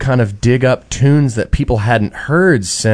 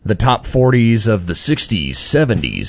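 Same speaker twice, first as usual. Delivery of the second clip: radio voice. Pretend. Guest listing his musical influences to interviewers, in playful radio voice. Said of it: "That's your radio voice going there?".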